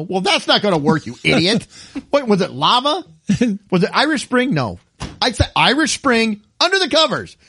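high, exasperated aunt telling off a patient; hammed-up exasperated telling-off; mock scolding. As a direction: scold the patient in pretend indignation.

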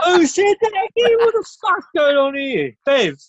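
high startled panicky yelp; suddenly spooked and shouting. Acted. Pub character hearing the hosts, high startled panic.

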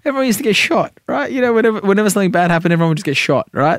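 high put-on voice of a dismissive mum; imitating mother. Continues imitating his mother.

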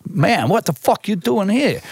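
high-pitched imitation of an incredulous local; put-on American street voice; alarmed New York stranger. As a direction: challenge him like a startled street guy. Stranger accosts him in New York.

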